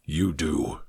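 very low and hushed; low, quiet challenge; quiet, pointed reply. A narrator's reading. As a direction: drop right down, soft and deep. Accusing reply to the reassurance.

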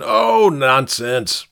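slow, drawn-out scoff; lightly spat, stretched-out protest; spat dismissal. Acted. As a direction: wave it away with drunken bluster. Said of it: "the man spat".

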